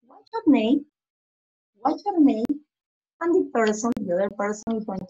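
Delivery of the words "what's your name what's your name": rising then falling question tune; model question. Shown as a demonstration. Teacher modeling the question to young pupils, clear. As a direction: say it clearly for the class, rise then fall. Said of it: "My question say".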